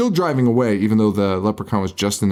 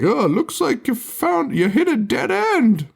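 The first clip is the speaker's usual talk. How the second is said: much higher, slow gloating leprechaun; 13 semitones higher than usual. Retold or imitated. Leprechaun gloating over dead Ozzy, high slow mocking voice. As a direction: squeak out the pun like a smug little leprechaun. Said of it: "the leprechaun's like".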